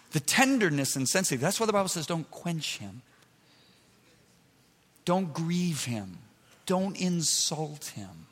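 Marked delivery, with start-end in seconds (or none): 2.0-8.3 slow, softened plea; solemn Father. Acted. God the Father protecting the Spirit, slow and solemn. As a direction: lightly voice a protective father, drawn out slowly. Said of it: "This is the Father speaking".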